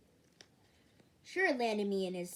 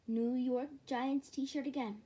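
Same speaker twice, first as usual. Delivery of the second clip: ordinary delivery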